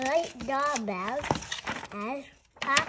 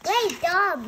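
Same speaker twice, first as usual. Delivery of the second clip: usual speaking voice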